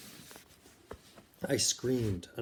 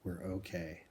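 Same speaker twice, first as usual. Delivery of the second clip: tired, softer reassurance; weary; 2 semitones lower, 7 dB quieter than usual. Acted. Susan after the bombing reassures the children, weary and soft. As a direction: let it sink out of her, worn out. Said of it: "Susan said wearily".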